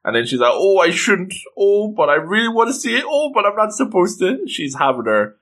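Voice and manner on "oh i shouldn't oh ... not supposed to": high-pitched, hammed-up dithering; conflicted. Girl tempted to peek at the spell, conflicted. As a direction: waver between temptation and guilt. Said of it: "you know, conflicted thoughts".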